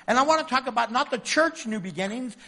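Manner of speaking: ordinary talk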